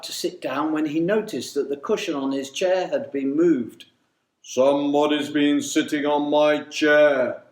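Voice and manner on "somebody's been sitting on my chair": loud, far-from-natural big bear voice; great deep bear voice. A narrator's reading. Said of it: "in his great deep voice".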